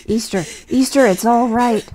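loud, high, insistent calming; urgent reassurance. Acted. Doctor calling to panicking Easter, loud and urgent. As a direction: cut through his panic with his name. Calming patient mid-attack.

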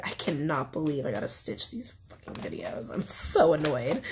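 grumbling under the breath, unvoiced; annoyed whisper. Real, not acted. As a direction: complain to yourself so nobody hears. Annoyed aside about editing videos.

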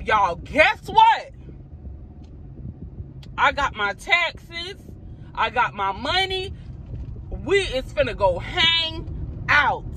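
high, slow, drawn-out happy news; sugary teasing announcement, stretched out; sweet fake surprise. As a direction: dangle the treat sweetly to the kids. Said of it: "I'm finna tell them".

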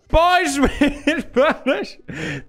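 very slow hyped call broken by laughter; laughing hype. Acted. Host announcing the meme again, laughing through a slow hype call. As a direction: draw it out while cracking up.

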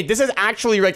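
usual speaking voice

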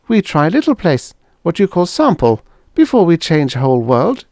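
high, loud, plainly reproachful alien; Venusian girl. Acted. Venusian girl telling Helen she always walked away.

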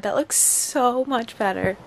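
usual speaking voice

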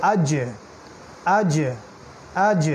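আজ with the "j" bounced, "aj-e"; echoing double sound. Shown as a demonstration. Teacher drills jim sakin, bouncing end on আজ. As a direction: release the final "j" of আজ into a tiny echo. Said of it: "double sound করে প্রতিধ্বনিমূলক শব্দ করে".

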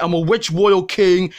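normal talking voice

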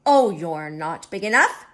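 loud, scoffing brush-off; grouchy bluff with a big oh; grouchy ladybug. Acted. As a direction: dismiss the rival with bluster. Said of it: "said the grouchy ladybug".

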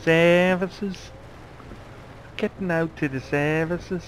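hammed-up Liverpool Scouse voice; Scouse accent. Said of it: "My brutal Scouse accent".

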